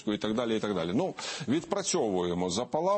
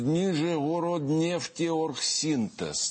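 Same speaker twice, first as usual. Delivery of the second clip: slow, higher, carefully drawn-out long name; tongue-twister name; 5 semitones higher, much slower than usual. Shown as a demonstration. Host compares the refinery name to tongue-twisters, saying it slowly. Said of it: "оті оці скоромовки тренувальні, це ніщо порівняно".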